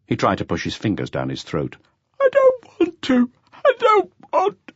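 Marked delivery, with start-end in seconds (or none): 2.2-4.7 high, slow, sobbing protest; sobbing plea. A narrator's reading. Jean breaking down before the officers, sobbing. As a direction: plead through sobs, much higher. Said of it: "furious sobbing".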